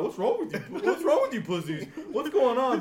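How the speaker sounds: high, loud, half-laughing shouts; loud acted outburst with laughter; mock exasperated yell. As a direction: yell at them in hammed-up disbelief. Acts himself yelling at panicking classmates.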